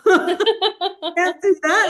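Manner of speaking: slow, high, laughing "Can't do that"; giggling mock protest; laughing refusal.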